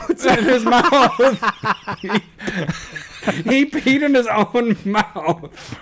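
high, laughing through the words; laughing while talking. Real, not acted. Dad telling friend his son's gross mishap, laughing. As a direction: crack up telling it.

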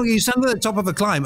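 usual speaking voice

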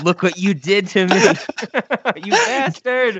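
high-pitched melodramatic accusation; mock outraged wife. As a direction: accuse him like a wronged wife, hammed-up. Acting out the sketch's wronged wife.

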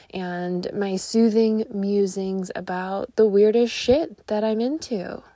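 ordinary delivery

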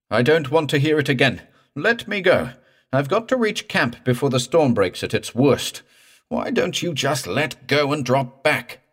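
high, loud desperate protest; frantic pleading. A narrator's reading. Morse racing the storm, pleading frantically with the corpse. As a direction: beg it desperately to let go. Morse begs the dead man off.

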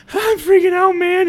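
high-pitched, loud freaking out; panicked shriek. Pretend. Guest tripping on the podcast, high panicked squeal. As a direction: squeal like you're losing it on shrooms. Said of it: "you're like".

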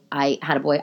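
usual speaking voice

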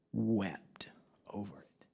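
low voice sinking almost to nothing; grave, hushed scripture reading; solemn hush. Real, not acted. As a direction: let the words fall away very softly. Solemn reading of Jesus weeping.

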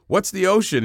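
put-on high, loud, puzzled young fish; high cartoon kid asking. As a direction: play the clueless kid fish. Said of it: "And then kids go".

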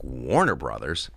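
low, slow wondering question; puzzled surprise. Retold or imitated. Comic seeing caller ID, low puzzled question. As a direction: say it slowly, rising in disbelief.

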